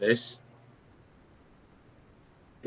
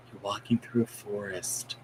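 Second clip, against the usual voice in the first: hushed, guiding hypnotist; soft hypnotist voice; 2 semitones lower, 10 dB quieter than usual. Pretend. Mock hypnotist leading a patient softly. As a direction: lull them into the trance.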